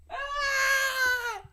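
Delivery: very high sudden cry; startled yelp. Real, not acted. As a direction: let out a sharp yelp.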